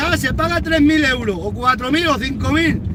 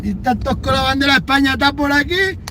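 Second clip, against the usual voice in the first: ordinary talk, nothing marked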